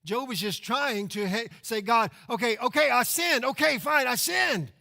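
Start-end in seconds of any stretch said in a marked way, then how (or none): 1.8-4.7 higher and louder, fed-up "okay, fine" shout; exasperated grudging confession. Acted. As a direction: toss out the confession like a fed-up teen. Job's grudging forced confession.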